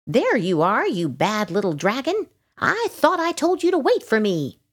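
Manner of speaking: high-pitched little scolder; scolding ladybug. A narrator's reading. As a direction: scold a naughty little one, voice climbing high.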